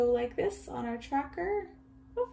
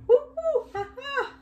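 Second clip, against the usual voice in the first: loud, very high monkey hoots; monkey call; 6 dB louder than usual. Pretend. Teacher reading along with children, monkey call. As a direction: whoop it out like an excited monkey. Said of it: "I wanna do a monkey voice".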